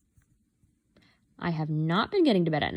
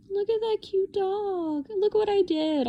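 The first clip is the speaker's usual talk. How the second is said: proud squeal; 13 semitones higher than usual. Real, not acted. Showing viewers her cute doodle, high and cooing. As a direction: coo over your own drawing.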